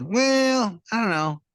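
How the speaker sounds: put-on young boy voice. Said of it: "he said".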